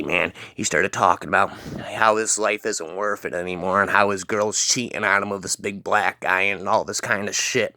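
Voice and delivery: usual speaking voice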